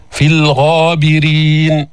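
slow, level tajweed tone; Quran recitation. Shown as a demonstration. Teacher repeats the verse ending, slow recitation. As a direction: hold the long final vowel.

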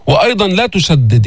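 usual speaking voice

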